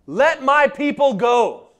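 a proclamation before Pharaoh; firm command. Retold or imitated. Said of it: "What's the first thing Moses says?".

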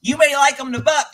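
high, loud mocking imitation of the scolding owner; mocking the horse owner. Retelling the owner scolding him for laughing, high mocking voice. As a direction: squeak it out like a prissy scold. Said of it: "And she's like".

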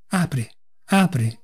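plain reading voice

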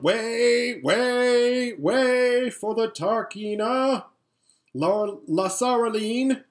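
loud, high, drawn-out street cry; crier's shout. A narrator's reading. Crier clearing the street for the Tarkina, shouting out. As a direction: call it out to clear the road. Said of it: "was heard shouting out".

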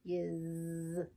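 low, soft little fly voice; a timid fly, barely speaking; tiny voice of a fly on the phone. A narrator's reading. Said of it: "A tiny voice said".

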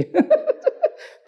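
tawa tinggi dan lepas; tertawa geli. Real, not acted. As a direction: tertawa geli pada cerita sendiri. Geli menceritakan ciumannya sendiri. (tertawa lepas)

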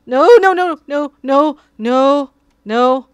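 loud, high panicked pleading. Real, not acted. Streamer yelling at his cat on the keyboard, panicked. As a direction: shout it up high as the cat heads for the keys.

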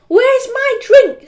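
high, loud, drawn-out question; imperious demand. A narrator's reading. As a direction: demand service like a spoilt boss. Margaret demands her drink.

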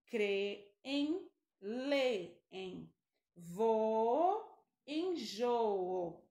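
creem drawn out slowly; slow example word. Shown as a demonstration. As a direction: stretch creem for the class. (creem bem devagar)

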